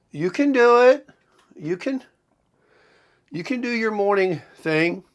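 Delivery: high, encouraging mock wife voice; imitating his wife. Quoting wife's encouragement, high and bright. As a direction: cheer him on like his wife would. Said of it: "She's even told me".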